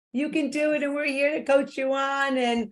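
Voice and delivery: rally everyone who is listening, pitch lifted; encouraging cheer. Real, not acted.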